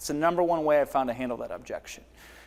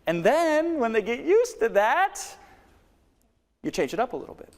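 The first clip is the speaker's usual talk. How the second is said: pitch change demo; 8 semitones higher than usual. Shown as a demonstration. Coach shifts pitch to show varied delivery. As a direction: lift the pitch way up to wake them. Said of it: "change up your delivery".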